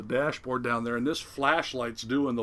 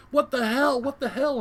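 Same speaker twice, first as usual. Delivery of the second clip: high, louder mock yell; pitched-up, incredulous yell; imitated yelling; 13 semitones higher, 7 dB louder than usual. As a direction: imitate alarmed men yelling from a deck. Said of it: "they're both yelling, you know, something like".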